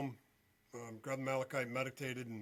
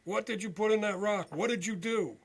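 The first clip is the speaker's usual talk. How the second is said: louder and higher, a re-enacted yell; retold scream; 6 semitones higher, 7 dB louder than usual. Tearful husband yelling at the psychic over the rock, raised and high. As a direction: throw the accusation like he's shouting across the lot. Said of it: "he's screaming".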